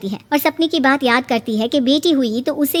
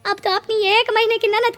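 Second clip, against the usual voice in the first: calling out; 9 semitones higher than usual. Acted. Neighbour calling out teasingly.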